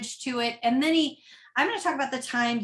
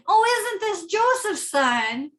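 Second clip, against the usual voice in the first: sarcastic tone; 8 semitones higher, 4 dB louder, slower than usual. Shown as a demonstration. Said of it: "read it with a different sort of tone".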